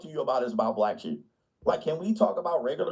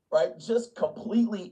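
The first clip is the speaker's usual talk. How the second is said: ordinary delivery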